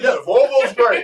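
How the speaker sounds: lightly mock the polite small talk. Shown as a demonstration. Code-switching to greet neighbor Jim, light mock suburban cheer. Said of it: "I can talk like that".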